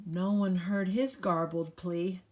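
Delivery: plain reading voice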